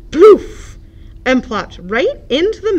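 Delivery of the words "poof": sudden loud poof. A narrator's reading. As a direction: punch the landing sound. Duck landing in leaves.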